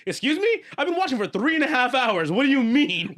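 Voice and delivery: high, loud incredulous squawk; hammed-up disbelief, voice jumping high; mock outrage. Fan shocked when the credits suddenly roll, loud high disbelief. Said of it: "It's like".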